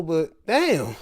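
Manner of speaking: ordinary talk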